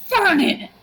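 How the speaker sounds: high, sharp mock scolding; scolding exclamation. Grandma calling out grandpa at the dinner table, mock scolding. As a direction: imitate grandma exclaiming in shock.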